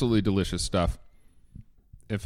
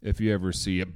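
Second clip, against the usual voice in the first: ordinary delivery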